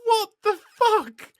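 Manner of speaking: high, loud, slow stunned exclamation; shocked. Real, not acted. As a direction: drag it out in disbelief. Mind blown reaction.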